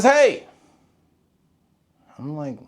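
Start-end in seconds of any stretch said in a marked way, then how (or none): 0.0-0.5 lightly hostile mock holler, high and loud. Neighbor's husband confronting the lawn worker, imitated hostile holler. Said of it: "he was in a hostile voice".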